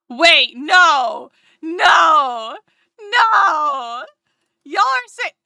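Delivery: loud high cry of no; dismayed shout at being wrong; real shock. Real, not acted. Realising pronunciation was wrong.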